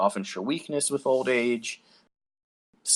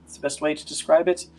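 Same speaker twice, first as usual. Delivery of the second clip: a hint of a timid healer; slightly higher, hesitant woman; lightly voiced young woman; 5 semitones higher than usual. GM voices NPC Augusta.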